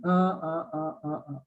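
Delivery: string of identical slack "uh" sounds; schwa sound. Shown as a demonstration. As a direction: say "uh" without moving lips or tongue. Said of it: "I'm not moving anything".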